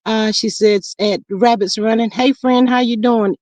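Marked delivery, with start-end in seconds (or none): none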